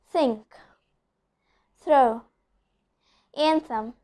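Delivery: "think", "throw", "anthem" with breath-only TH; devoiced TH. Shown as a demonstration. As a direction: model words "think", "throw", "anthem", each apart, TH unvoiced by the vocal cords. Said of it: "examples for the D voiced one".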